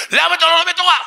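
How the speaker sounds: very high, loud sustained yell; shouted comeback. Shown as a demonstration. Student drowning out his challenger, full-blast shout. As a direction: scream it back on one high note. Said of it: "אתה צועק לו".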